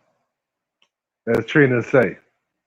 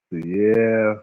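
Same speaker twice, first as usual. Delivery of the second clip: normal talking voice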